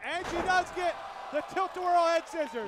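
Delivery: high, loud excited call; excited shout. Real, not acted. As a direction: shout the big move as it lands. Calling a big comeback move.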